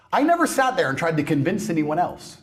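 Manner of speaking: usual speaking voice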